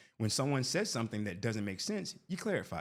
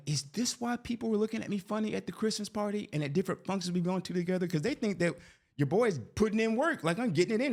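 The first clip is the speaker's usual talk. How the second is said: high-pitched, worked-up retold questioning; incredulous mock outrage; 10 semitones higher than usual. Husband asking wife about funny looks at parties, incredulous mock outrage. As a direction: act out a comic realisation in a raised pitch. Husband acts out indignant realisation.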